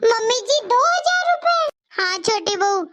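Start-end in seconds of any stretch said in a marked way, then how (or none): 0.0-1.6 high-pitched surprised question; astonished. Acted. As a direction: rise sharply in disbelief at the amount. Surprised at money handed over.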